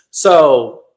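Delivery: ordinary talk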